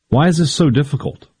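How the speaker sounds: ordinary talk, nothing marked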